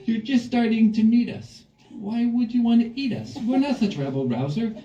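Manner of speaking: put-on high, sweet child's pleading; pleading children. The captured kids sweet-talk the monster so he won't eat them.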